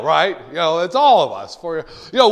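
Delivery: ordinary talk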